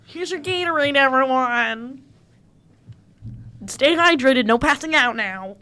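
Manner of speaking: lightly hammed-up water boy call; playful water boy. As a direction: pretend to hand out drinks to a team. Voices herself as water boy.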